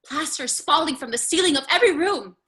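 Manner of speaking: loud, high protest. Acted. Daughter confronting her raging father, loud protest. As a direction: shout him down over the noise.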